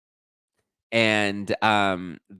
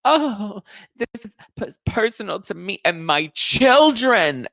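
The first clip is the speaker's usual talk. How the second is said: much higher, louder, slow fake-wounded whine; mock victim whine; 12 semitones higher, 7 dB louder, slower than usual. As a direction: ham up the pearl-clutching martyr. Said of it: "they're just like".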